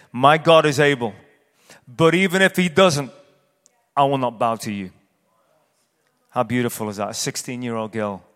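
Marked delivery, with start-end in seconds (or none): 0.1-4.9 defiant proclamation. Acted. Preacher voicing a believer facing the fire, loud defiance. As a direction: ring out the refusal to bow.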